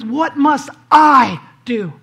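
"What must I do?" slow and shouted, with I hit hardest; stress on I. Acted. Pastor exposes the ruler's self-focus, slow shout on "I". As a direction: drag it out and slam the word "I". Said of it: "his question starts with, it screams, "I".

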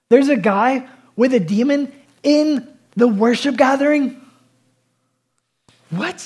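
high, loud, slow disbelief; the preacher playing up outrage to the congregation, high disbelief; mock shock. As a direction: act scandalised, stretching it out. Mock shock at demon in worship.

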